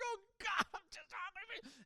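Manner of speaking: loud, high-pitched imitated wail; hammed-up desperate cry of prayer; crying out to God. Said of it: "I'm just crying out to God".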